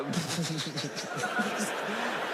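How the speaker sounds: long mock fit of stifled laughter; imitated congregation giggles. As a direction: mimic a church trying to hold in giggles. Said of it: "And the church is like".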